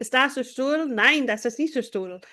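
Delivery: mock-stern textbook German; harsh-sounding German. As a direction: recite German drills as if scolding. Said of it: "things that don't sound nice".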